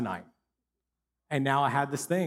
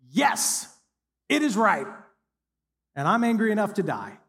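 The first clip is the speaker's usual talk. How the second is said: defiant retort; 6 semitones higher, 4 dB louder than usual. Acted. Jonah answers God defiantly.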